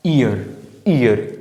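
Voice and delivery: "ईयर" starting on a clear ee vowel, no y before it; vowel-first ईयर. Shown as a demonstration. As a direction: sound out "ईयर" from a pure ee. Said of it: "तो ये ई की आवाज़ दे रहा है". ("ईयर" की शुरुआत साफ़ ई से, य के बिना)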